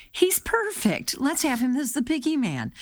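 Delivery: high-pitched, excited casting exclamation; lightly acted, high-pitched eureka; mock enthusiasm. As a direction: play a casting person thrilled by their find. Voicing delighted casting people in retelling.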